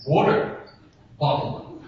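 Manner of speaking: water bottle with the t flapped to a d; Canadian water bottle. Shown as a demonstration. Preacher showing Canadians their D for T. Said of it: "you guys replace it with a D".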